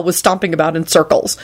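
ordinary delivery

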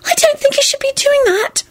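very high, frantic little voice. A narrator's reading. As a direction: squeak a frantic warning. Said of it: "Serena whispered frantically".

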